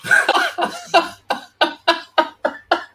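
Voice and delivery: high-pitched staccato laughing fit. Real, not acted. Fan reacting to a late Ukraine winner, laughing. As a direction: laugh in high, separate bursts that thin out.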